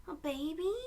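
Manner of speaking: a light, rising surprised question; a mild hint of Aiden's surprise. Aiden hearing the baby news, with light surprise. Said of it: "Aiden asked".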